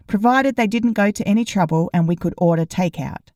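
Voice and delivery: ordinary delivery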